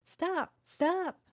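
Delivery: high, loud panicked shout; yelling. A narrator's reading. Boy shouting at the dog running out, panicked yell. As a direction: scream to make the dog halt. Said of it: "Scott yelled".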